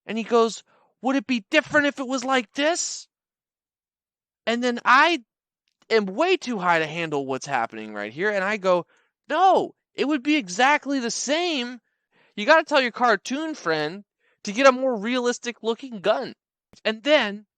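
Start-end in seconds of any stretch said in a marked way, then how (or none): 1.0-3.1 high, loud taunting threat; threatening guy voice. Retold or imitated. Guy showing gun tattoo. 9.3-16.3 high incredulous comeback. Retold or imitated. Stoned reply to threat.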